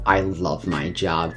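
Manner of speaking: sarcastic mumble. Retold or imitated. The manager muttering to himself after the customer storms out, a slow low mumble. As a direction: mumble it to yourself, deadpan and weary. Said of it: "mumbles to himself".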